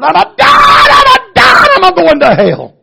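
screamed high and loud in terror; desperate shrieking wail; anguished scream. Retold or imitated. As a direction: cry out like a damned man dying. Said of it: "he caught his breath and he said".

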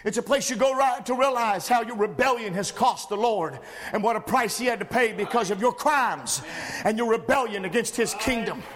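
ordinary delivery

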